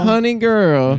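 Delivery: sassy diva voice. Pretend.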